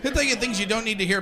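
normal talking voice

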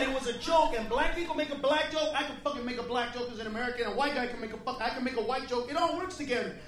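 normal talking voice

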